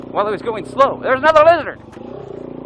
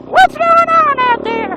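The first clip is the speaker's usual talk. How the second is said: slow, much higher put-on southern drawl; southern girl voice; 6 dB louder, slower than usual. Candy's sister shouting from the door, southern girl voice. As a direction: call out from the doorway as a southern girl. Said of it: "that's the only girl thing I can do".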